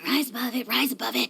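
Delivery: quiet muttered self-calming; calming himself. Acted. Dragon lord muttering to calm his own temper. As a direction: talk yourself down under your breath.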